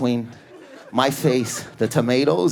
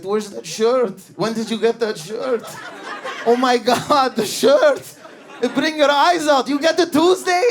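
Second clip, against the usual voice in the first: pushy shawarma guy; 8 semitones higher, slower than usual. Retold or imitated. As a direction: grill him about the shirt, pitched way up. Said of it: "but he's like".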